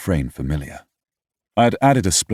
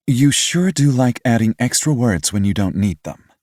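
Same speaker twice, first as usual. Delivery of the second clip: higher, teasing mother voice with a knowing eye-roll; mother's teasing voice; 6 semitones higher, 4 dB louder than usual. A narrator's reading. Mother teasing her wordy son, playfully. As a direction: tease him like a fond mother. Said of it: "rolling her eyes".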